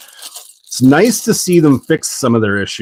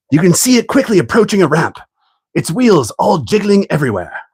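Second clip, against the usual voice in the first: high, hushed British nature-documentary narration; mock British wildlife narrator, pitched up; David Attenborough impression; 7 semitones higher than usual. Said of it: "Just butchering your accent".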